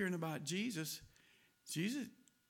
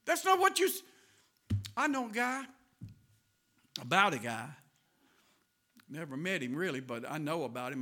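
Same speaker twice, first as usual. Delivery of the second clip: ordinary talk, nothing marked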